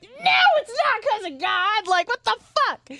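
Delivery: high, drawn-out exasperated mock yell; retold yelling. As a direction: yell back in comic outrage, pitch soaring. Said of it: "So I'm, like, yelling at her".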